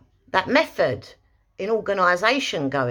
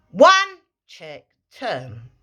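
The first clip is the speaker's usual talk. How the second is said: sharp cue; 2 semitones lower, 5 dB louder, much slower than usual. Shown as a demonstration. Trainer adding the voice cue to the footwork. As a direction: snap out one. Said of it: "you can then add".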